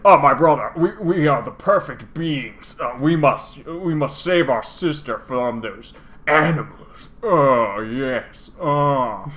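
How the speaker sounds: slow, grandiose melodrama; pompous dragon twin. Acted. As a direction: declaim it like a hammy stage villain. Voices Nicole's grand speech.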